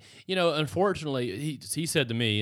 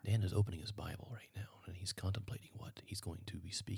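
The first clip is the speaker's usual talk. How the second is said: hushed golf announcer whisper; golf commentator whisper; 3 semitones lower, 13 dB quieter than usual. Acted. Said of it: "they do the whisper".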